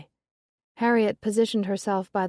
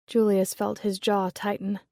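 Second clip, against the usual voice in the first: ordinary delivery, nothing marked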